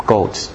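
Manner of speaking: "Goats" said low as "golts"; accented echo. Shown as a demonstration. Preacher checking his own slip, low accented "Goats". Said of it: "Getting an accent".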